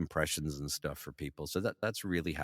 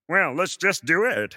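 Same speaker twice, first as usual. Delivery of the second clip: resigned put-on voice; 12 semitones higher, 10 dB louder, slower than usual. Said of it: "I'm like".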